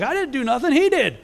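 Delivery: high, mock-innocent denial; innocent son. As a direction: play the cheeky kid pretending he did nothing. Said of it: "He's like".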